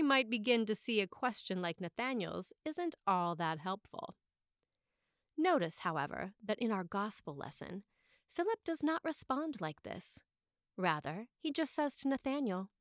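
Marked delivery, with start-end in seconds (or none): none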